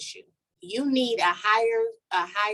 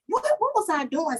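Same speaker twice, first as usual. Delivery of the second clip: baffled; 8 semitones higher than usual. Retold or imitated. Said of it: "I'm wrecking my brain".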